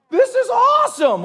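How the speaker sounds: high, loud delighted exclaim; thrilled soldier. Retold or imitated. The soldier cheering his first soul winning, high excited exclaim. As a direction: gush it out with joy. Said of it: "the soldier's like".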